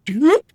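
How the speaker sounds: sudden high, loud "Doop" with a rise; imitating a friend's last noise before crashing; Max's doop. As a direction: yelp it out like a startled squeak. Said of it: "Max will go".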